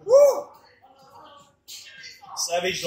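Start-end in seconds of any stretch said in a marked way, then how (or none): 0.1-0.5 very high, loud whoop; excited whoop. Real, not acted. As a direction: let out a wild cheer. Cheering during chili challenge.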